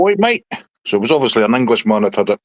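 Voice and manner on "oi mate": very high parrot call; high-pitched mimicking bird voice; parrot impression. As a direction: imitate a parrot hailing someone. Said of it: "it used to go".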